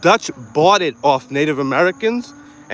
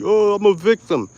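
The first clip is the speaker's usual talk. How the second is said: a touch higher, whiny mock complaint; mock victim whine; 6 semitones higher than usual. Mocking complainers as whining victims, lightly whiny. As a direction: whine it like a sulking child. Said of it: "that's what you sound like".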